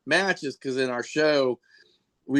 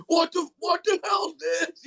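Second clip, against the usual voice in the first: mock outraged shout. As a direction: shout like a spectator just doused. Crowd sprayed with fake spew.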